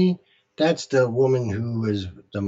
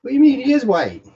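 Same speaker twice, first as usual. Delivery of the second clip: incredulous; 12 semitones higher, 6 dB louder than usual. Real, not acted. Incredulous retort.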